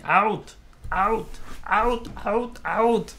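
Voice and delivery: high repeated mock shout of out; hammed-up high yelling out. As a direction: chant out out out like throwing everyone out. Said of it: "And then them going".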